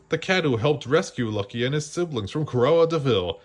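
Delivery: ordinary delivery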